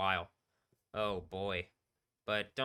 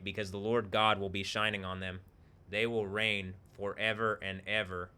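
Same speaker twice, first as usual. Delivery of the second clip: scripture reading; 6 dB louder than usual. Acted. Streamer reading Revelation aloud to the players, solemn. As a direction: read it like a sermon passage.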